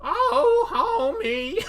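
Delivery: very high, loud, strained female voice; badly faked falsetto wail; Marge Simpson. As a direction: screech it as a hammed-up cartoon wife. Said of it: "every female voice I do would sound the same".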